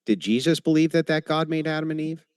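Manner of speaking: ordinary talk, nothing marked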